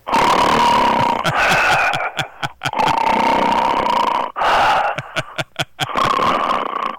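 long, noisy comic snores; fake snoring. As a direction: pretend to be sound asleep, snoring away. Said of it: "you sounded so awake".

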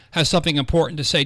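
ordinary talk

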